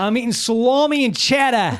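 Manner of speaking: laughing Boston accent try. Shown as a demonstration. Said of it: "say it in a Boston accent".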